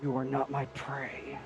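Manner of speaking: slow, soft growl of a predator; quiet, slow beast voice. Acted. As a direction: dismiss them like a hunter sparing prey. Werewolf dismissing the party.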